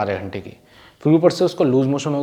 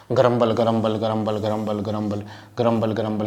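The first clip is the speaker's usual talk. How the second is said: low grumbling under the breath, softer and lower; drunk man grumbling; 6 semitones lower, 5 dB quieter than usual. Acted. Teacher acts the drunk man grumbling after hot rum, low mutter. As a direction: mutter it like a tipsy man complaining. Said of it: "वो बड़बड़ाने लगा".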